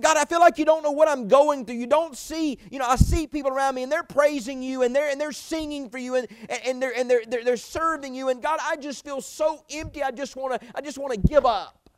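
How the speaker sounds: high, loud, pleading and raw; preacher reliving his lowest moment, shouting to God; desperate angry prayer. Retold or imitated. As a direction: cry out to God like you're at the end. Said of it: "And I was angry".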